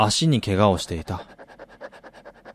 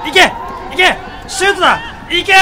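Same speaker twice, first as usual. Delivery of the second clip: cheering shout; faster than usual. Acted. Fan yelling at the forward to shoot. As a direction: scream him on toward goal.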